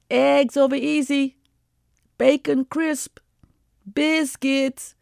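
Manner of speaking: high, slow sing-song order call; waitress calling orders. Retold or imitated. Waitress calling orders through the window to the cook, high and drawn.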